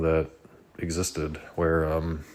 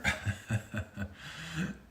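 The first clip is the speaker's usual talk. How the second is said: soft laugh; 9 semitones higher, 10 dB quieter than usual. Real, not acted. Laugh while calling cat.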